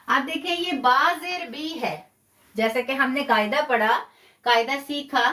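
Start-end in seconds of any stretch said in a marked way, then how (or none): none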